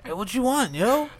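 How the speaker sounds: high, slow, spaced-out voice; stoned drive-thru worker. Retold or imitated. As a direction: play the baked cashier.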